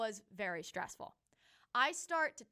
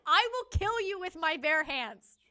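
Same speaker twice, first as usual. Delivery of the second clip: mock threat; 5 semitones higher, 8 dB louder than usual. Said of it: "And I was like".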